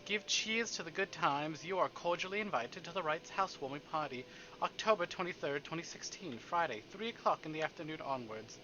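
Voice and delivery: ordinary talk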